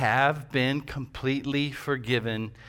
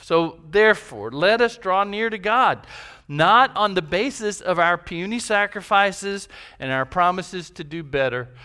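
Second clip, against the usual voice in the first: louder, higher preacher's call; preaching at full force; 6 semitones higher, 6 dB louder than usual. Acted. Pastor urging the church to draw near, raised preaching. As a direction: drive it home to the congregation.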